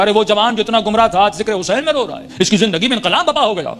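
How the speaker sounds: high, marvelling exclamation; amazed neighbours. Retold or imitated. (حیرت سے بلند آواز)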